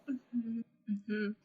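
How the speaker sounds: very soft, small mm-hmms; awkward murmur. Retold or imitated. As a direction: hum along meekly, feeling out of place. Acting out standing there out of place.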